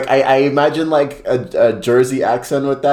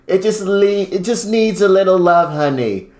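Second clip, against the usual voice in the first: higher-pitched, badly faked Jersey accent; 7 semitones higher than usual. Contestant redoing the quote for laughs, high bad Jersey accent. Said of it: "imagine with the Jersey accent".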